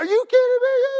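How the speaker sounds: high, loud mock indignation; hammed-up exasperated outburst. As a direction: blurt it like you're losing it. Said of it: "not saying".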